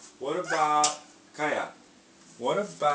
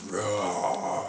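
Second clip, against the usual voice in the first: slow bear roar; 5 semitones lower than usual. Shown as a demonstration. Showing bear sound.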